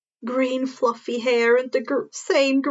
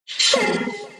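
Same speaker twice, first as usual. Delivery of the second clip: frantic curse; 8 semitones higher than usual. Acted. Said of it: "Fourth frantically says".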